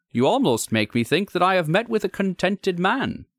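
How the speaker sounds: high-pitched, genial gentleman visitor; lively visitor making polite conversation; curious visitor. Acted. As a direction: pitch it well up, as a friendly gentleman caller. Visitor's dialogue in narrated story.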